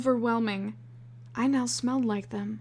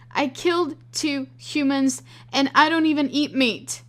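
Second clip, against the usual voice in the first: panicked; 5 semitones higher, 5 dB louder than usual. Acted. Panicked confession.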